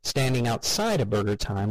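ordinary delivery, nothing marked

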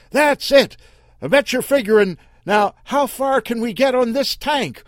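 loud, high mocking taunt. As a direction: needle them with every question. Earl taunting the car aloud.

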